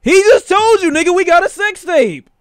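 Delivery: high, loud incredulous yell; loud exasperation. Real, not acted. Viewer shouting at the courtroom show, loud and incredulous. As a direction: shout the obvious at the screen.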